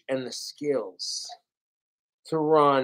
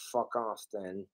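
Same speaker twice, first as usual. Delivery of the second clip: muttered brush-off; 7 semitones lower, 13 dB quieter than usual. Real, not acted. Sulky brush-off to British viewers.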